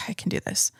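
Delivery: sighing, barely voiced self-encouragement; whispered pep talk. Real, not acted. Podcaster steadying herself before a hard admission, whispered. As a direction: whisper it to yourself on a sigh.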